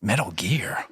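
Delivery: breathy whispered soldier growl; Snake impression. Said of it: "I can do an okay Snake impression".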